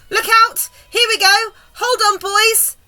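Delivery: high, loud excited calls; warning shouts. A narrator's reading. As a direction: yell to the crew to brace themselves. Crew calls out as peach lifts.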